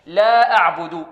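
slow, loud "لا أعبد" with لا stretched two counts; measured recitation, holding the vowel of لا in "لا أعبد"; two-count madd. Shown as a demonstration. Teacher demonstrating separated madd, measured stretch on لا. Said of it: "هنا قلنا مد منفصل".

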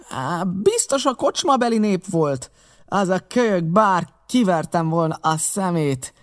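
high, bitter outcry that sinks; angry cry. A narrator's reading. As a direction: cry out in vengeful frustration. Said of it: "kiáltott Pew".

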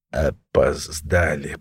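low, slow, creaking voice; creaky. A narrator's reading. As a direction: creak it out laboriously. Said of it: "произнёс Смятый скрипуче".

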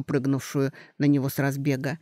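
plain reading voice

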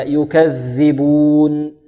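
"يكذبون" ببطء شديد وذال من طرف اللسان; مدّ "يكذبون" مبينًا الذال المشددة; إخراج الذال. Shown as a demonstration. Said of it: "تخرج من منتهى طرف اللسان". (ضع طرف اللسان بين الأسنان في ذال "يكذبون")

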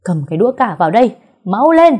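fast, loud barked order; urgent shout. A narrator's reading. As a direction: bark it, hurried and loud. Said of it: "Cậu Quyền quát um lên".

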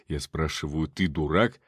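restrained menace. A narrator's reading. Uncle presses stammering nephew, low and controlled anger. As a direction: drop it low and demand an answer. Uncle repeats question, reining it in.

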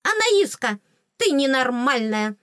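high fairy voice, falling in exasperation; scolding fairy. Acted. Fairy tells Anaiska she is not normal, high scolding. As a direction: pitch it up like a dismayed little fairy.

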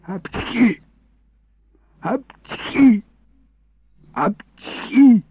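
three high acted sneezes; sneezing. A narrator's reading. Boy crouched by a fence at night, sneezing. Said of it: "чихнул я три раза подряд".

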